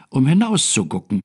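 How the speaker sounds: ordinary delivery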